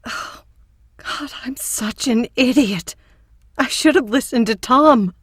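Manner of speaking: frightened whisper. Acted. Terrified after hearing tape.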